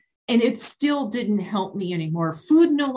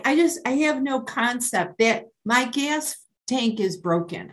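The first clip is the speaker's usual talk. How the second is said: normal talking voice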